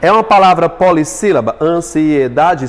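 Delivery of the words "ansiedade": "Ansiedade" slow, in five syllables. Shown as a demonstration. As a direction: sound out the five parts of "Ansiedade". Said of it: "são cinco".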